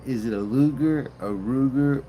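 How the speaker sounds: usual speaking voice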